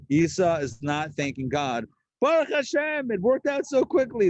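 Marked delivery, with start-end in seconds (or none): 2.2-4.3 high, bright-eyed thanks to God; a holy-sounding son, pitched up high; pious gratitude. Retold or imitated. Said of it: "That's not an Esau way of talking".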